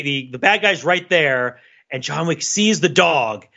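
usual speaking voice

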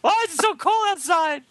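high whiny freezing complaint; freezing whine. Pretend. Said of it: "Then he's like".

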